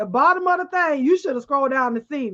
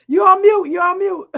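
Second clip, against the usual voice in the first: calling out; 5 semitones higher, 7 dB louder than usual. Real, not acted. Telling cohost she's muted.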